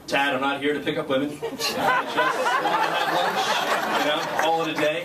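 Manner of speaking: laughing protest. Retold or imitated.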